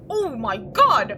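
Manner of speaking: loud, drawn-out shock; shocked outcry. Real, not acted. Player reacting to Frou Frou's sudden attack, loud shocked cry. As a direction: burst out slowly in real alarm.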